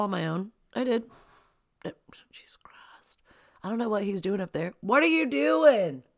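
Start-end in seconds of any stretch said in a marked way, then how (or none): 1.8-3.2 whispered exasperation. Real, not acted. Reacting to son upstairs. 4.8-6.0 loud, higher yell upstairs; mother yelling up the stairs at son; calling out. Real, not acted.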